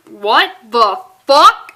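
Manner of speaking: slow, drawn-out disbelief; incredulous. Real, not acted. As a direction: stare at the page and drag it out. Shocked at comic panel.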